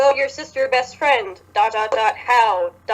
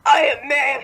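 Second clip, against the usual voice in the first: laughing mock caveman boast; mock macho man; 4 semitones higher than usual. Mocking Bellamy as a macho man, laughing. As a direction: play a dumb macho guy. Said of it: "He's in".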